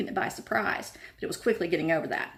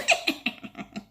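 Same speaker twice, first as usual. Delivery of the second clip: high breathy cackle; poltergeist cackle. A narrator's reading. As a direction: cackle with mischief. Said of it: "Peeves cackled".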